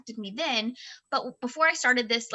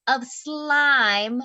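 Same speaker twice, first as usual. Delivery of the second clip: ordinary talk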